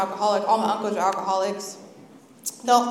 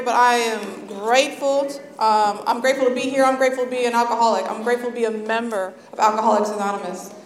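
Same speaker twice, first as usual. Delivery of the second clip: normal talking voice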